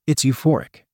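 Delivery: usual speaking voice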